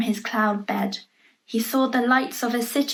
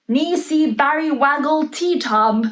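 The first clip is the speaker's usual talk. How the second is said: magic words; 2 semitones higher, 5 dB louder than usual. A narrator's reading. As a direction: chant the spell like the Queen casting it. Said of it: "said the magic words backwards".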